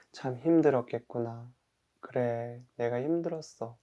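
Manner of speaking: soft, soothing acceptance; understanding self-talk. Pretend. Person who overslept comforts their tired self, understanding. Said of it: "이해하고 인정하고 존중해주기".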